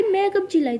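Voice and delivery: high, indignant protest; mild shout. Acted. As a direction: deny it with voice pitched right up. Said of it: "आप चिल्ला रही हो".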